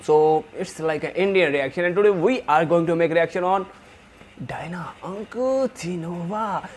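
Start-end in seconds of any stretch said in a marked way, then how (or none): 4.5-6.7 whispered name. Real, not acted. Whispers the singer's name reverently.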